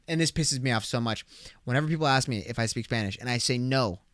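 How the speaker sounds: ordinary talk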